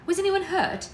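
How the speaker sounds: high, loud alarmed question; alarmed mother. A narrator's reading. Mother hearing of a fire, alarmed. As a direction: jump up high in concern.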